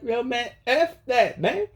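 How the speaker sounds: high, slowed voice of the spirits urging him on; spirit beings voice. Retold or imitated. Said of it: "They're like".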